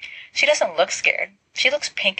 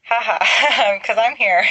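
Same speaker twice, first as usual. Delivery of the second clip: laughing through the words, a little higher and louder; 5 semitones higher, 5 dB louder, slower than usual. Acted. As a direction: chuckle it out, pleased with himself. James laughs at his own joke.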